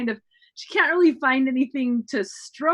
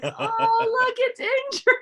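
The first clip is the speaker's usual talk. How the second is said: high, mock-pitying coo; cooing movie impression; 8 semitones higher, slower than usual. Recalling a Galaxy Quest moment, high mock-pitying coo. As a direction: imitate someone fussing over something tiny and cute. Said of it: "the little one that they're like".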